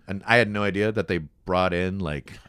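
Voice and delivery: usual speaking voice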